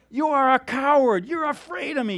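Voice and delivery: loud, high-pitched accusation; taunting challenger. Retold or imitated. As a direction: taunt him so the whole saloon hears. Young gunfighter taunts veteran in story.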